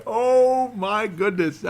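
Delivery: high, loud burst of shocked delight; surprised squeal. Real, not acted. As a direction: yelp in amused disbelief then laugh. Reacting to a funny comment.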